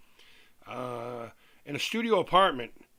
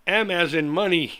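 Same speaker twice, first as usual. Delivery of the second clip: M as in money, louder, M stressed; spelling out the letter; 3 semitones higher, 8 dB louder than usual. Shown as a demonstration. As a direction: spell the letter out firmly, punching M. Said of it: "That's another word".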